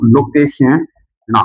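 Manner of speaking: लोकेशना stretched out syllable by syllable, very slow; drawn out slowly. Shown as a demonstration. Teacher dictating लोकेशना to students, very slow. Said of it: "ये लो दोस्तों".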